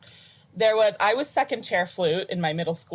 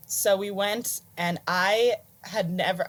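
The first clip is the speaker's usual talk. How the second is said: ordinary delivery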